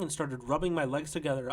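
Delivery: plain reading voice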